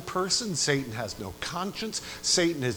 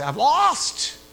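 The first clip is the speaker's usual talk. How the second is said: mock concession; 13 semitones higher, 7 dB louder than usual. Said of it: "Satan should come forward and say".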